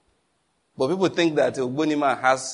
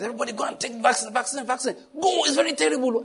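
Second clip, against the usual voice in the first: much higher, mock panicked alarm, with a laugh; 10 semitones higher, faster than usual. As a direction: ham up the frantic warning. Imitating panicking vaccine campaigners.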